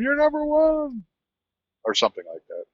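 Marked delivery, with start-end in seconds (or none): 0.0-1.0 high, drawn-out mock holler, held on the last word; mock distant screams. Passing drivers hollering at a slow trucker, high mock holler. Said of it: "They were probably saying".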